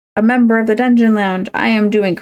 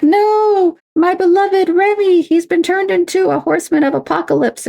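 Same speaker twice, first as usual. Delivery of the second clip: Rogue dramatic; 11 semitones higher than usual. Retold or imitated. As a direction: ham up a lovesick Rogue, pitched way up. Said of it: "then Rogue will be like".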